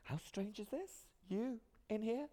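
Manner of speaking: high, slow incredulous mimicry; mock-astonished casting assistant, drawn out and high; imitated casting assistant. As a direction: put on a high-pitched voice of disbelief. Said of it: "casting assistant being like".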